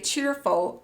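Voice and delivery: usual speaking voice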